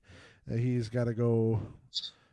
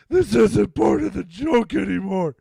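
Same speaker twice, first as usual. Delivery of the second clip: higher, louder, wailing mock sob; imitating a crying Joe; 6 semitones higher, 7 dB louder than usual. Host tries the crying prison scene, a loud hammed-up mock sob. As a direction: bawl it out as a hammed-up breakdown. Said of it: "let's see if I can do it".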